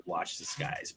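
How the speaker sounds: hushed ominous whisper. Retold or imitated. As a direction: breathe it like a secret warning. Ominous quote from Q.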